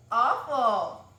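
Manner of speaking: P=A; loud groan of disgust. Retold or imitated. Disgust at the mice.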